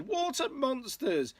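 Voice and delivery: high storybook yelp; mock frightened cry. A narrator's reading. Sister sees reflections in the water, mock frightened cry. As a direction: cry out in acted alarm. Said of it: "and one cried".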